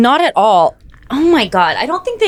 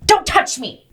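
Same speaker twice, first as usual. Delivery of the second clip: put-on snapping at child; 10 semitones higher than usual. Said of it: "she was like".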